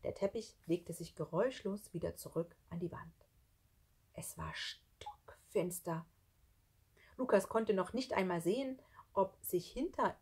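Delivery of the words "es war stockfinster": slow, whispered narration; soft, drawn-out, eerie; suspenseful hush. A narrator's reading. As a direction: whisper it slowly into the darkness. Narrator hushed in the dark.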